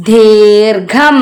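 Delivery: ദീർഘം loud and very slow; loud, drawn-out model reading of ദീർഘം; reading demonstration. Shown as a demonstration. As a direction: sound out ദീർഘം firmly, both parts held. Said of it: "ഇതെന്താ വായിക്ക?".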